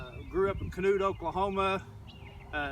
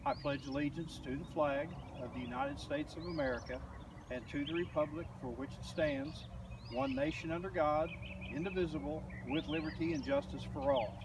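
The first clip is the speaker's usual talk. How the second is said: soft, measured group recitation; pledge recitation; 3 semitones lower, 7 dB quieter than usual. Acted.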